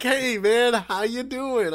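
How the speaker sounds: high, loud, slow mock greeting of a friend; mimicking a buddy's breezy call, slow and high; imitating a casual buddy. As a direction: ham up the friend's breezy hello, stretched out. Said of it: "He's like".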